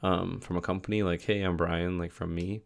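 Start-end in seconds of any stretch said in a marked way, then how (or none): none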